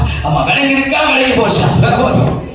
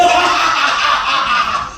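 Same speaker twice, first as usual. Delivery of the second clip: long put-on belly laugh; mock carefree laughing fit; demonstrated laugh; 3 semitones higher than usual. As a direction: fake a hearty laugh. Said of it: "அப்படி சிரிப்பீங்களா?".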